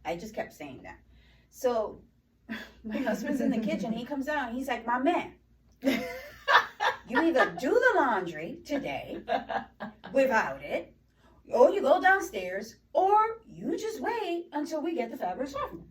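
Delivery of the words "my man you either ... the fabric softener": imitating a fed-up husband, loud and high, laughing through it; exasperated ultimatum. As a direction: lay down the options slowly and forcefully, half laughing. Husband steps in, acted ultimatum to son.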